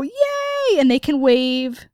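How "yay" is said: high-pitched crowd cheer; mock cheer. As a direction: cheer like a delighted audience. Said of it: "we can all go".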